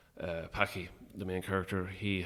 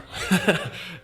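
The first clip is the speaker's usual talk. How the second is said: a sudden, much louder, unvoiced laugh; burst of laughter; 11 semitones higher, 12 dB louder than usual. Real, not acted. Guest laughing at his own "chancer" answer, loud unvoiced laugh. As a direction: laugh out at your own joke.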